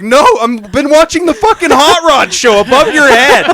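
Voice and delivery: high, loud retold protest; acted-out indignant shout; exasperated shouting. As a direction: yell your defence as if wrongly accused. Retold quarrel, shouting his defence.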